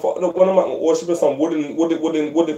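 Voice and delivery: climbing into an outraged, incredulous rant; worked-up rant. Real, not acted. Riled up, ranting about worship.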